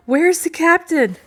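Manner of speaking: high, loud fearful question; frightened demand. A narrator's reading. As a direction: demand it in alarm. Frightened woman demands of stranger.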